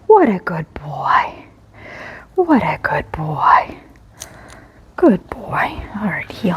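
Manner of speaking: slow, soft whisper of praise. Real, not acted. Trainer soothing the dog in down-stay, whispered slowly.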